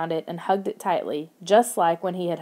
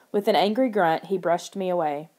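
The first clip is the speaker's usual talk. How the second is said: usual speaking voice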